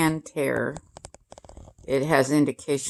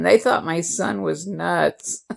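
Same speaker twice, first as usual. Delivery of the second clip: ordinary talk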